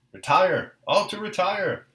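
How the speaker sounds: loud, high, drawn-out order; shouting from a distance. A narrator's reading. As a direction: call the retreat across a battlefield, stretching the words. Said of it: "he shouted from a distance".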